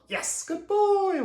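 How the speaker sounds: very high, slow, sing-song praise; happy praise. Shown as a demonstration. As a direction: stretch it out high and happy for the dog. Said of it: "I tell my dog".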